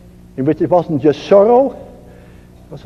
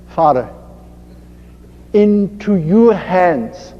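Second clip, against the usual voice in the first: slow, lowered voice with long pauses; surrendering prayer; 4 semitones lower than usual. Acted. Jesus prays to his Father from the cross, slow and surrendering.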